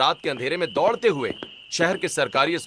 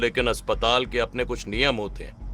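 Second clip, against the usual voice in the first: low, restrained professional voice; grave doctor; 3 semitones lower than usual. Acted. Doctor warns family to pay by morning, grave. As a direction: explain the rules soberly. Doctor explains hospital rules.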